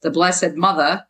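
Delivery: "Mother" said as "MUTH-a"; slow, with Jersey "Mother" losing its R; Jersey accent. Shown as a demonstration. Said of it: "M U T H A".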